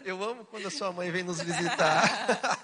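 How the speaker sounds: loud, laughing mock compliment; joking flattery. Husband teasing wife about mother-in-law visits, laughing. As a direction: declare it loudly through laughter.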